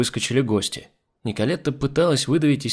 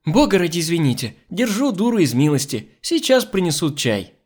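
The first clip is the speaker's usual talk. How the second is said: apologetic hostess; 6 semitones higher, 3 dB louder than usual. Acted. Hostess excuses maid to guests.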